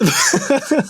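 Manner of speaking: sudden high squeal of laughter; high burst of laughter. Real, not acted. Laughs at the bird captcha.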